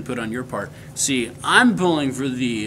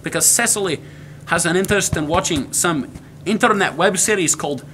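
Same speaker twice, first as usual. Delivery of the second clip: put-on Scottish accent; Scottish brogue; 3 semitones higher than usual. Showing off the family heritage accent, Scottish brogue. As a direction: chatter away in a lively Scottish brogue. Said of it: "to talk in the brogue".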